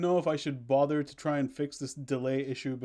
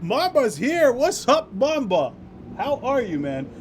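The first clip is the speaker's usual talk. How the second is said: high, loud welcome shout; excited greeting; 9 semitones higher, 6 dB louder than usual. Real, not acted. Streamer greets a raider joining the stream with a loud excited welcome. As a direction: hype the new arrival up.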